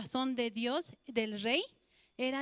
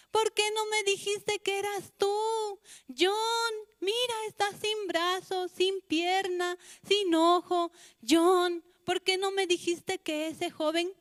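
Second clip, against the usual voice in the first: anguished lament; 5 semitones higher, 4 dB louder than usual. Acted. Mother laments over son's body.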